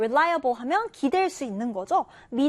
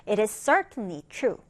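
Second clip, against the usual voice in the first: very slow careful reading; slow model English sentence; 3 semitones lower, much slower than usual. Shown as a demonstration. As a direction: read slowly for learners. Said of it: "reading model sentence". (아주 천천히 영어 예문)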